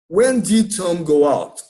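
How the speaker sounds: slow, carefully spaced English model sentence; pronunciation model. Shown as a demonstration. Teacher gives the class an English sentence to repeat, pronunciation model. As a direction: say it slowly for learners to repeat. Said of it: "pra vocês pegarem a pronúncia".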